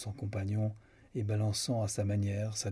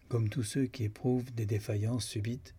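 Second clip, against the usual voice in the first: ordinary delivery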